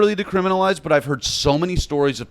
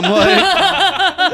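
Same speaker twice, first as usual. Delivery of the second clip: laughing; 13 semitones higher, 3 dB louder than usual. Real, not acted. Cracking up mid-story.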